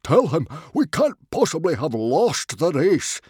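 high, loud, desperate protest; gasped appeal. A narrator's reading. Stoic begging Old Wrinkly to back him, gasping protest. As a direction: plead loudly in shocked disbelief. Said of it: "gasped Stoic".

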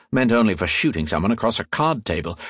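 ordinary delivery

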